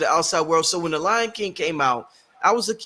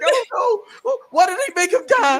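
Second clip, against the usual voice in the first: high whiny mock sob; high, whiny hammed-up upset; mock crying; 5 dB louder than usual. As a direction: whimper it like a kid over the movie. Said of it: "I'm like".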